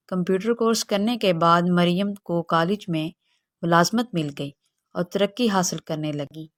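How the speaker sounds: usual speaking voice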